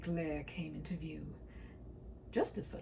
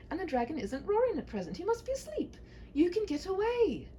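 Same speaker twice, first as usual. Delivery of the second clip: lightly voiced princess, higher and urging; a princess hurrying the knight off; 10 semitones higher than usual. Princess urges the knight to escape, higher and eager.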